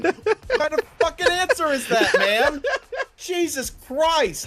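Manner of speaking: high, loud disbelieving rant; loud, high and laughing in disbelief; exasperated. Real, not acted. Exasperated rant about coworker.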